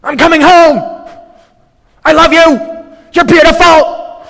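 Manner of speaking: high, booming shout on every phrase; over-emphatic yelling, far above normal pitch; exclamation-point shouting. Shown as a demonstration. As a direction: shout each short line like it ends in an exclamation mark. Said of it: "If we only use exclamation points".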